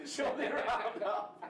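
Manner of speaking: pleading through laughter; laughing plea. Real, not acted. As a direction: beg again while cracking up. Begging to round up score.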